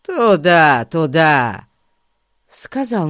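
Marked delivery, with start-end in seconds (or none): 0.0-1.7 louder, menacing dark-lord command; sinister voice. A narrator's reading. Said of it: "таким зловещим голосом".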